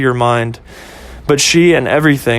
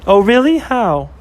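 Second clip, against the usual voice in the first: put-on higher, curious girlfriend voice; girlfriend Ashley; 7 semitones higher than usual. Girlfriend asking about his day, higher and curious. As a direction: perk up and ask, pitched high.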